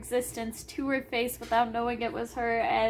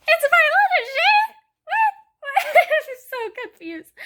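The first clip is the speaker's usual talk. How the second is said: high, loud squeals of shock breaking into laughter; shrieking disbelief; 14 semitones higher, 8 dB louder than usual. Real, not acted. Reader squealing at a plot twist, high and loud. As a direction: squeal it out, can't believe it.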